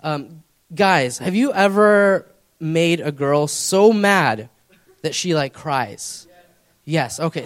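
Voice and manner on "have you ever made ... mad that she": ordinary talk, nothing marked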